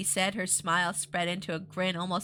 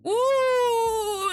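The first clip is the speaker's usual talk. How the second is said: outraged cry; 12 semitones higher, 7 dB louder than usual. Real, not acted.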